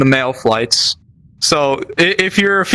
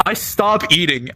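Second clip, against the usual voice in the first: ordinary talk, nothing marked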